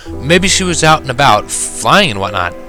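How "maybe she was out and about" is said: usual speaking voice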